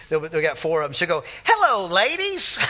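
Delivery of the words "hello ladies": high, slow sing-song hello; Crystal greeting chickens. Retold or imitated. Crystal greeting her four chickens in the yard, high slow sing-song. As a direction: coo at the hens sweetly. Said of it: "she always goes like this".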